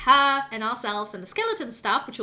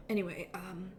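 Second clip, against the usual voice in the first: whisper; 3 semitones lower, 9 dB quieter than usual. Real, not acted. Whispered aside.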